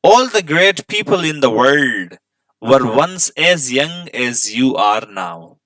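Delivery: drawn-out reading of an English line for students; teacher reading a lesson aloud, slow and careful; slow textbook reading. Shown as a demonstration. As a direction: read it slowly so pupils can follow. Reads textbook line slowly to class.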